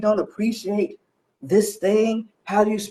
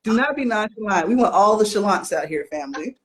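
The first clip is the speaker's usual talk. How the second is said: ordinary delivery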